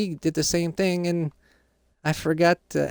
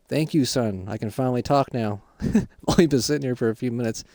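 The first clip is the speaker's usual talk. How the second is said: dad voice; 5 semitones lower than usual. Acted. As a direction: lower voice as the dad just out of prison. Kid voices his dad.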